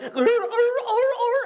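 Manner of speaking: high, slow imitated grunting; dog seizure noises. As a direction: mimic a convulsing dog.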